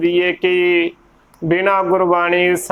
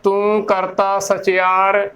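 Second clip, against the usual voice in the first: slow, careful scripture line; slow recitation; slower than usual. Shown as a demonstration. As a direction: recite each word deliberately. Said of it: "ਉਹੀ ਉਚਾਰਨ ਕਰਨਾ ਹੈ".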